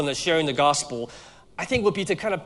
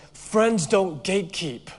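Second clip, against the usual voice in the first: slow, higher-pitched solemn retort; mock serious; 5 semitones higher than usual. Friend answering a gatekeeping joke, mock serious and slow. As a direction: imitate a friend turning very serious. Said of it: "She turned very serious and she goes".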